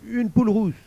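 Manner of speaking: high, eager offer; woman bargaining. Acted. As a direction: pitch the offer high and loud. La femme fait une offre au démon. (femme qui marchande, voix aiguë)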